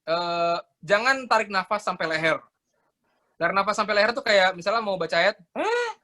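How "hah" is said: shallow gulp of air before reciting; sharp mouth inhale. Shown as a demonstration. Teacher showing wrong breathing before recitation, mouth gasp. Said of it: "ditarik pakai mulut tariknya".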